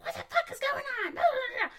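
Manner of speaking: high, fast mock yell of an angry mom. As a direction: squawk it like a furious mother. Imitating yelling mom.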